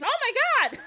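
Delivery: very high scared squeal; frightened princess. Acted. Princess seeing zombie rise in barn, squealing high.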